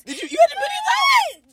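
high, disbelieving shout; shocked question. Real, not acted. Friend hearing the story, high shocked shout.

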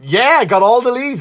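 loud, high pretend boast about the raked leaves; mock proud boast. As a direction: crow it like a job well done, hammed up. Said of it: "Now I can just go".

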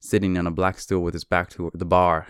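usual speaking voice

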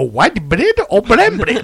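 put-on slow, laughing South African accent; a hint of a South African accent. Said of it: "squeeze that South African accent in".

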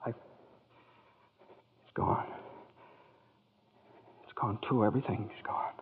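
breathless, barely voiced dismay; stunned whisper. George whispering to Clarence as the bell vanishes, stunned. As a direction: let the words drain away in shock.

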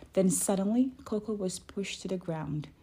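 ordinary delivery, nothing marked

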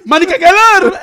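loud, high mock exclamation; hammed-up shout. Man replaying his blurted words to laughing friends, shouted. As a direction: belt out the nonsense phrase for laughs. Repeats joke line for laughs.

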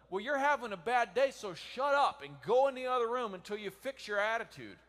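loud, high angry snap; harsh scolding spouse. Acted. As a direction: bark it like a fed-up spouse.

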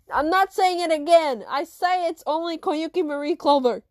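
loud, insistent recital; fed-up refusal. Acted. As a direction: put your foot down loudly. Pupil refuses to repeat name.

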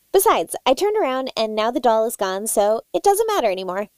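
ordinary delivery

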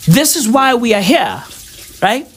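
ordinary talk, nothing marked

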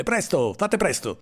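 high-pitched urgent plea to hurry; urgent order. A narrator's reading. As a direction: hurry the driver along, pitch climbing. (ordine concitato, in tono acuto)

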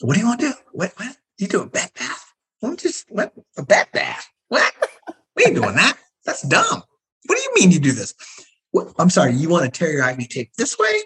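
mock outrage at the routine; scoffing at nursing school habits; incredulous. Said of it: "I'm like".

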